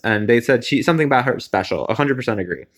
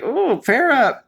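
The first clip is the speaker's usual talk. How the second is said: much higher, falling oh Farrah; sympathetic aww; 11 semitones higher, slower than usual. Retold or imitated. Fan feeling for Farrah in the bottom, sympathetic sigh. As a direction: soften toward her like a proud fan.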